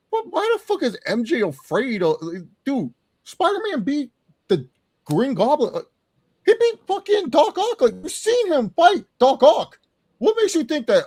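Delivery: high-pitched, exasperated disbelief; incredulous rant. Real, not acted. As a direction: argue the plot hole in high-pitched outrage. Ranting at a film character's logic.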